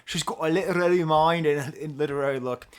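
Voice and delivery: loud, hammed-up Scottish accent. Shown as a demonstration. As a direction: belt out the line in a mock Scottish accent. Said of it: "I'll do it with a Scottish accent".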